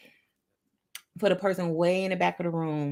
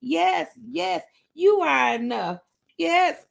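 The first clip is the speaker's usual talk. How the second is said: high, rhythmic, dancing-along delivery; gleeful hype to a beat; excited self-affirming; 8 semitones higher, slower than usual. Acted. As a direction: cheer yourself on in a high voice. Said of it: "this is what happened when my affirmation".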